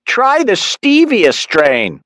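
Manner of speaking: high, slow mother's voice; Mom voice. A narrator's reading. Mother urging her son on the phone, high and slow.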